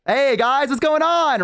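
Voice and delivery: high-pitched, chummy hello; imitated friendly greeting. Other orcs greeting the group, chummy.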